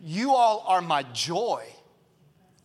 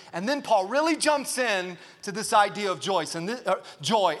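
usual speaking voice

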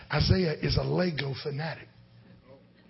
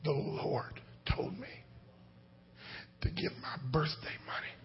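put-on whispered, softer, like a small boy; child's whisper; 2 semitones lower, 9 dB quieter than usual. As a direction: whisper it like a shy little son. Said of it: "he came into my bedroom ... he said".

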